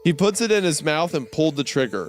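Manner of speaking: a lightly dramatic read-aloud of a creepy tale; story narration. Acted. Reading a creepypasta to a podcast cohost, mock-serious narration.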